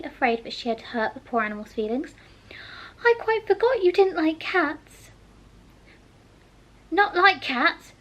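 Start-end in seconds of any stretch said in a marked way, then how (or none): 3.0-5.1 put-on a girl sorry for her blunder; Alice's voice. A narrator's reading. Alice apologises. 6.9-7.9 high, louder, slow outraged question; indignant squeak at the very idea; shrill, passionate. A narrator's reading. The mouse, offended, answers Alice shrill and passionate. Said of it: "in a shrill, passionate voice".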